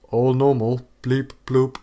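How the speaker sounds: robot beeps; fake robot voice. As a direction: beep like a computer. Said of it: "very rehearsed computer noises".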